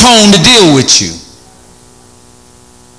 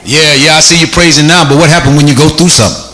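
dismissive downer; 5 semitones lower than usual. Shown as a demonstration. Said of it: "say something to bring it all down".

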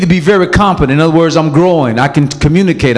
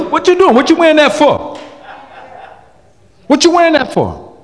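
much higher, incredulous scolding; mock scolding husband; 10 semitones higher than usual. Preacher voicing a husband scolding his wife's outfit, incredulous. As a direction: chide her outfit.

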